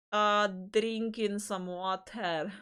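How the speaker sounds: slow, exaggeratedly stretched words; oddly drawn-out. Real, not acted. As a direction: draw each word out playfully. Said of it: "why did I say it like that".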